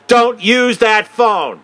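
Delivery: retold guard shouting; imitated yell. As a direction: bark the order at the room. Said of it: "stand in front of them and yell".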